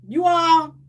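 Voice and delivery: ordinary talk, nothing marked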